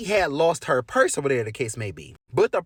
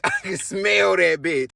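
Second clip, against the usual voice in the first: slow, laughing and louder; laughing drunk friend; 4 dB louder, slower than usual. Retold or imitated. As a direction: giggle through the repeat.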